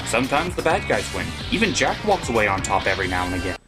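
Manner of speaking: ordinary talk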